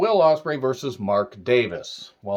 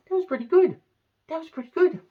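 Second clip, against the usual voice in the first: high, cooing pet voice; baby talk to cat; faster than usual. Acted. Praising his cat producer in high baby talk.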